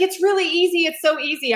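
mock-delighted friend insisting indie is easy. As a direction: gush it like it's nothing. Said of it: "so delighted with".

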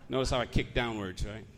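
ordinary talk

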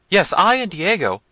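high, loud flustered interruption; consternation. A narrator's reading. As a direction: butt in high and loud, in alarm. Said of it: "still greater consternation".

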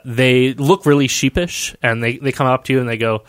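ordinary talk

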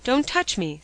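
loud, sharp refusal; imperative. A narrator's reading. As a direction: push her away with words. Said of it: "waving her off with an imperative hand".

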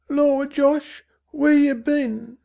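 high, hushed Cockney mother; mother's voice. A narrator's reading. Mother hushing Dicky to sleep, high and hushed. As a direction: soothe the boy in a tired mother's voice. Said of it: "Dicky heard his mother say".

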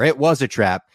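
mock alarm, called out; retold exclamation. Host correcting the quote to his co-host, exclaimed.

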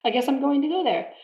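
high, mock-resigned agreement; resigned shrug. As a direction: shrug it off, pitched high and light. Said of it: "It's like".

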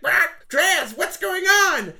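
very high squawky bird voice; Bixie voice. Acted. Bixie asking the lizard what's happening. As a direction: chirp it up, alarmed. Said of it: "he's like".

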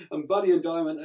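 usual speaking voice

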